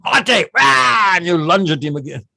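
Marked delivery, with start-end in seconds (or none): 0.0-0.5 short, high acted attack call; yelled command. Trainee out of the water with rifle ready, yelled command. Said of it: "And you yell". 0.6-1.1 high, loud attack roar; acted war yell on the lunge; yelled battle cry. Trainee lunging from the water with rifle, battle yell. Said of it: "And you yell".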